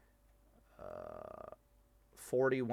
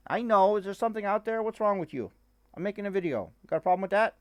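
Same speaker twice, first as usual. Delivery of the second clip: higher, mock-stern chatter at the dog; asking the dog if it has a problem; talking to the dog; 8 semitones higher, 4 dB louder, faster than usual. Real, not acted. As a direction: tease the dog like it can talk back. Teasing chat to the dog.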